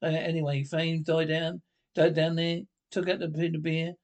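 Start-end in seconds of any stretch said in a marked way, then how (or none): none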